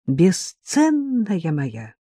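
very slow, teasing drawl; drawn-out endearment. Acted. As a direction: stretch it out fondly. Playful drawn-out address.

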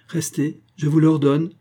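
terrified cry. A narrator's reading. Baroness crying out to the count in panic. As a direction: order him to stay, panicked. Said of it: "cria Adeline d'une voix terrifiée".

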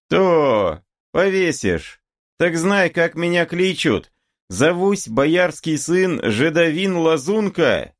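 high-pitched, swaggering call; cocky young challenger, drawing out the last words; boastful taunt. A narrator's reading. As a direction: call your name after him proudly, pitched high. Boyar's son taunts departing Cossack.

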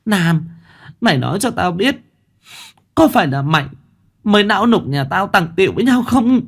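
high-pitched wailing confrontation; screamed demand. A narrator's reading. Chanh confronting Lam about her husband, screaming. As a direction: cry out an accusation. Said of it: "mà gào lên".

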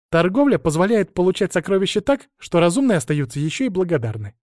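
put-on eager dragon girl. A narrator's reading. Dragon girl backs her master's plan of trading, eagerly. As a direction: enthusiastic little dragon reciting a lesson.

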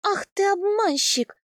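high, drawn-out indignant squeal. A narrator's reading. Heroine accuses her husband of tricking her, high squeal. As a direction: shoot the pitch up in mock outrage. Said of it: "взвизгнула я".

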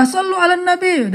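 high-pitched imitated call over a quarrel; mock calling out. Bystander calming angry bus passengers, calling out high. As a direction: cut into an argument with a high cry. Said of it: "marah-marah".

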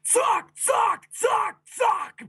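loud, very high battle shouts; explosive separate yells; roaring. Acted. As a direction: roar each word like a blow. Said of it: "brüllte da mit jedem Schlag".

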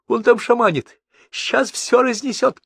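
high, loud, agitated voice; alarmed warning. A narrator's reading. Frantic passenger warning about the shaman, alarmed. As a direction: warn them with the pitch pushed right up. Panicked passenger warns the pilot.

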